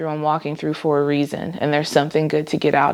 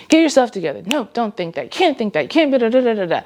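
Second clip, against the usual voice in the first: harsh self-talk; 5 semitones higher, faster than usual. Retold or imitated. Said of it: "the negative version of pep talk".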